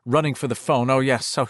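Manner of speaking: ordinary delivery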